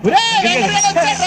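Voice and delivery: high, excited call to the gang; excited announcement. Acted. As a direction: announce his arrival to everyone. Announces a friend's arrival. (ఉత్సాహంగా అరుస్తూ)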